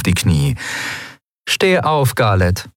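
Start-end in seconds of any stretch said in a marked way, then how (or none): 1.5-2.7 half amused. Acted. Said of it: "halb amüsiert".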